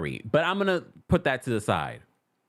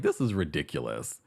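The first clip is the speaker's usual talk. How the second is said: deadpan dismissal; 9 semitones lower, 4 dB quieter than usual. Retold or imitated. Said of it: "I was like".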